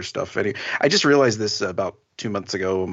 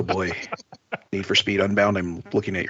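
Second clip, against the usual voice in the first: ordinary talk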